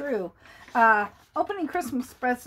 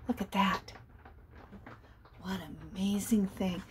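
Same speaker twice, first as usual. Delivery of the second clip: soft, lower, awed murmur; hushed and amazed; hushed wonder; 6 semitones lower, 10 dB quieter than usual. Real, not acted. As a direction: marvel quietly at the picture. Wonder at the book picture.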